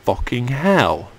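ordinary talk

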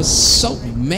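"so mad" stretched out low; drawn-out hook. Shown as a demonstration. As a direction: say "so mad" with long, dragged vowels. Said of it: "that's how I say it, though".

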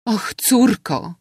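plain reading voice

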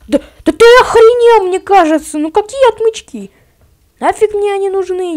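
loud, high outraged yelling; indignant shout. Real, not acted. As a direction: yell it in disbelief. Outraged at the game. (возмущённый крик)

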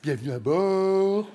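put-on slow, smooth cabin-crew welcome with no trace of accent; polished steward greeting. Steward welcoming passengers aboard, polished and slow. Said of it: "j'ai gommé mon accent".

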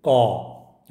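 loud, harsh imitated caw; harsh crow caw. Shown as a demonstration. Teacher imitates the crow's harsh cry: loud caw. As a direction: croak it out like a crow. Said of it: "इसका sound होता है कर्कश आवाज़".

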